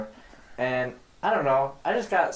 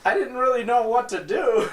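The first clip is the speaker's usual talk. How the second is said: high, laughing awkward friend; Zach impression; 10 semitones higher, 4 dB louder than usual. Friend admits he was lost, high laughing Zach. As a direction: giggle through it as Zach. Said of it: "Your Zach impression is fantastic".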